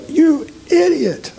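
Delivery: very high, slow mimicking voice; mock scolding. Someone berating him afterwards, mock high-pitched scolding. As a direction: squeal the insult as an imitated scolder.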